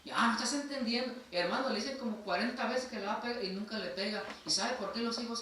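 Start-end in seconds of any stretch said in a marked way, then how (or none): 0.0-1.1 high, loud fed-up parent; exasperated parent. Retold or imitated. Parent fed up with a child who won't listen, high exasperated tone. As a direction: sound fed up with the kid.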